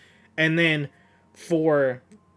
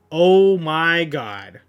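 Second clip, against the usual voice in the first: slow, drawn-out awed oh my God; stunned awe; 4 dB louder, much slower than usual. Real, not acted. Fan reacting to a manga panel, slow stunned awe. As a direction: let it sink in, stretched out in disbelief.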